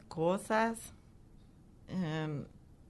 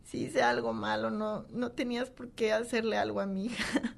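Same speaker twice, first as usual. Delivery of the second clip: higher-pitched, wounded reproach; recalling a tearful protest, voice lifted; retold tearful reproach; 4 semitones higher than usual. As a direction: plead with God, as if through tears. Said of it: "llorando y le decía".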